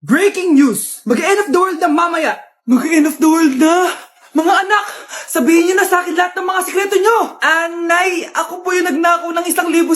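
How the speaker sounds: loud, panicked announcement; mother shouting the end-of-the-world news to her kids; frantic shouting. Acted. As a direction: yell the news like the world is ending.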